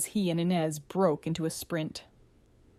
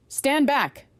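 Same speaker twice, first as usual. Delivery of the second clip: sharp warning; 8 semitones higher, 5 dB louder than usual. Acted. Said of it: "said Iran over his shoulder".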